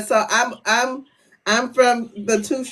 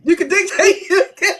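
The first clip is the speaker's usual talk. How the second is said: laughing; 8 semitones higher than usual. Real, not acted. Laughs at husband's threat.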